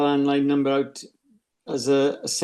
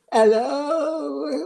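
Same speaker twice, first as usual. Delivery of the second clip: much higher bright-pitched mock hello; sing-song hello; 11 semitones higher than usual. Man answering a scam call, mock high sing-song hello. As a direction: answer the phone in a put-on high chirpy voice.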